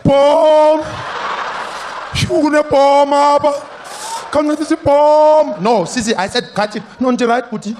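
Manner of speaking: put-on loud high shouting in South African accent; shouting woman, thick South African accent. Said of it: "This woman will be there like".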